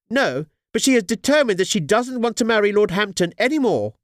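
high-pitched worried mother of the bride; anxious Lady Henry. Acted. Narrator voices Lady Henry.

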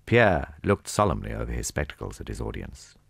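usual speaking voice

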